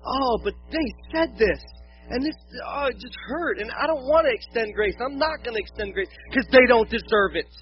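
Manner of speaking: aggrieved complaint. Acted. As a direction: play someone who refuses to forgive. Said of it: "And we're like".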